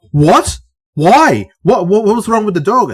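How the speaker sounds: high, loud mock outrage; rising, incredulous retold questions; imitating shocked disbelief. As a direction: act out an appalled listener, voice jumping up. Shocked young workmates hearing film's plot.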